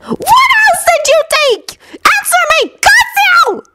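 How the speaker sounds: very high, screeching interrogation; furious yell. A narrator's reading. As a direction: shriek the demand at a captive. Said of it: "Bellatrix yelled".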